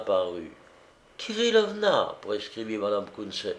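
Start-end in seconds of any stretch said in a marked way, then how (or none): 1.2-2.2 high-pitched summons, falling at the end; calling a servant. A narrator's reading. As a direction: call out for the maid. Mistress calls her servant.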